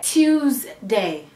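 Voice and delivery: Tuesday said with a Caribbean accent; light Caribbean lilt on Tuesday. Shown as a demonstration. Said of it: "the Caribbean in me saying Tuesday".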